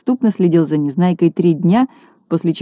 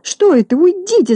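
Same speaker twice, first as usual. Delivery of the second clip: high, shrill-style squeal of disgust; acted high-pitched shriek; 12 semitones higher than usual. A narrator's reading. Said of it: "завизжала Минога".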